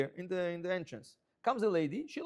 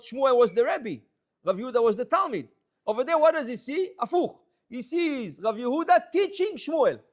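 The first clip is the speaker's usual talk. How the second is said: raised and louder with excitement; excited; 5 semitones higher, 8 dB louder than usual. Real, not acted. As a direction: tell it as a big revelation. Excited telling of reversed world.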